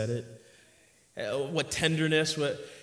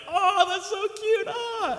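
much higher, sing-song mock coo; cooing onlookers; slower than usual. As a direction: gush like a stranger melting at kids. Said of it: "They're like".

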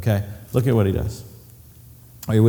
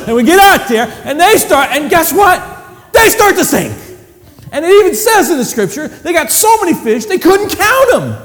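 loud, high, building excitement; excited storytelling; 13 semitones higher, 7 dB louder than usual. Real, not acted. Preacher marvels at the boats sinking, loud and excited. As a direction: marvel at the catch.

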